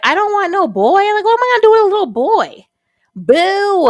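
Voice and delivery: high, loud, fast whining complaint; whiny protest. Retold or imitated. Protesting to God about a boy, high whiny complaint. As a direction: pout and whine like a spoiled child. Retold protest at God's message.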